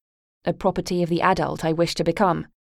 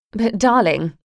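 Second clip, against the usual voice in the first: higher, louder mock endearment; malicious smile; 4 semitones higher, 5 dB louder, slower than usual. Mother needling her daughter, a malicious smiling darling. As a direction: smile while you stick the knife in. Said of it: "with a malicious smile".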